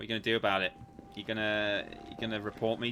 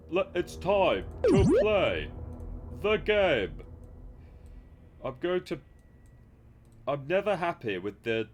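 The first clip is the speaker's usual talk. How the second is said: announcer voice gone astray; 4 semitones higher than usual. Acted. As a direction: put on a hammed-up show announcer voice. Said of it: "it was originally the announcer from the X Factor".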